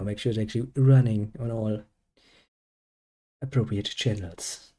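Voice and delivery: ordinary delivery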